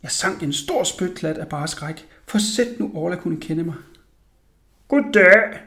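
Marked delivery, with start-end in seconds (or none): 4.9-5.6 loud, put-on man's greeting; deep voice. A narrator's reading. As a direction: greet him loudly as a pretend grown man. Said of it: "sagde jeg med min dybe stemme".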